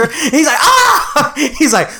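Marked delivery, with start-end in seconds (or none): 0.6-1.3 very high startled squeal; cartoon shriek. Patrick reacting to SpongeBob's promotion, cartoon shriek. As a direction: yelp like a panicked cartoon starfish.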